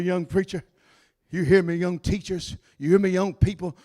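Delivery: usual speaking voice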